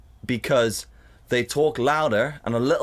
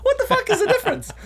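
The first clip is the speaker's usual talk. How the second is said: much higher incredulous laughing question; laughing disbelief; 3 dB louder than usual. Retold or imitated. Onlooker comparing mob and MPs, laughing disbelief. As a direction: squeak it out in amused disbelief. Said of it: "You're like".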